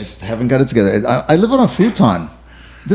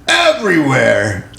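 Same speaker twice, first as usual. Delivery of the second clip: Al Pacino impression; much slower than usual. Friend quoting the movie line as Al Pacino. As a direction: stretch the word out like Pacino. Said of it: "you can do Al Pacino?".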